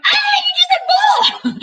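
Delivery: very high, drawn-out delighted squeal; retold excited shriek. Family reacting to baby's first word, imitated excited shriek. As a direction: squeal it as the thrilled family did.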